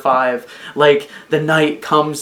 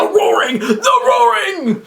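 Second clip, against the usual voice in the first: very high, slow, drawn-out cry; hyped exclamation; 3 dB louder, slower than usual. Real, not acted. Fan hyping the coming roaring, very high and stretched. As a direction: shout it like a big reveal.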